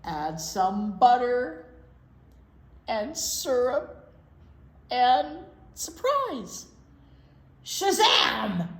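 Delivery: bouncy sing-song storybook reading; reading to children. Acted. Librarian reading pancake rhymes to kids, sing-song. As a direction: read the rhyme aloud to little kids. Reads rhyming picture book aloud.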